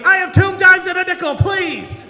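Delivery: high, loud shout for help; desperate yelling. Acted. Passenger yelling at crew on a plane, frantic shout. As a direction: yell it out in mock panic. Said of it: "you're just yelling at somebody".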